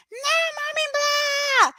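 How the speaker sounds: high mock toddler wail; imitating whining child. Three-year-old refusing mom, imitated high whine.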